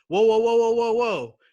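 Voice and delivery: loud, high run of whoas; imitated alarm. Imitating medic who spots a snake, loud alarm. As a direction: call out a sudden halt, acted out.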